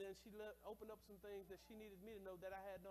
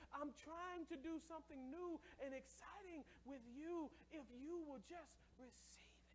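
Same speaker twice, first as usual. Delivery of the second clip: gentle voice of God; 6 semitones higher, slower than usual. Acted. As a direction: coax them gently. God's voice, softer and slower.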